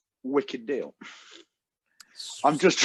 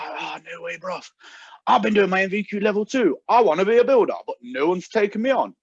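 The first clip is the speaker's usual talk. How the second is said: imitated salesman accent, pitched up and building louder; Aaron's accent; 5 semitones higher, 4 dB louder than usual. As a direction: mimic an excitable phone lad's accent, getting louder. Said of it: "I'm not exaggerating this accent".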